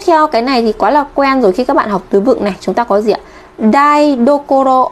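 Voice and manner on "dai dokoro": Dai dokoro read out slowly, each syllable clear; slow model reading. Shown as a demonstration. Teacher modelling Dai dokoro for students, slow and clear. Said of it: "Dai dokoro tức là cái chỗ vị trí bếp".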